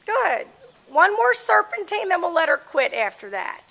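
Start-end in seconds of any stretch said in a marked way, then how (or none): 0.1-2.1 high called-out command; brisk projected riding commands. Real, not acted. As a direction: call it out across the arena. Coach calling to rider.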